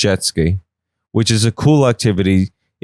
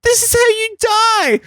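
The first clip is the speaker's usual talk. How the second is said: very high, drawn-out mock cackling voice; taunting brain voice; slower than usual. Other side of the brain egging on laughter, high taunt. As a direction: play the gleeful other side of the brain, squealed slowly. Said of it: "other side of your brain is like".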